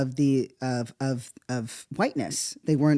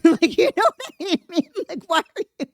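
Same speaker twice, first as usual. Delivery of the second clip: laughing through the words, much higher; can't get the words out for laughing; 5 dB louder than usual. Real, not acted. Laughing at the memory.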